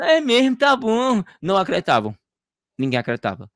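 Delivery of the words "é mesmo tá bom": high, sarcastic "sure, sure"; mock disbelief. Townspeople doubting the healed daughter, high mocking disbelief. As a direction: brush him off with a disbelieving high voice. People mocking his claim.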